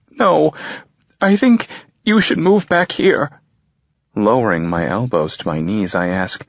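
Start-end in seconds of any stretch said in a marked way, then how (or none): 0.1-4.7 high, louder mother's voice; a mother insisting through tears; mother telling her son to move home, high and insistent. A narrator's reading. Mother on the phone.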